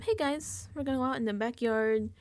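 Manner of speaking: high squealing fake party cheer; rising to a giddy high Yay, woo; fake cheerful card. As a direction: sell the party like a bubbly host. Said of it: "the card lies and says".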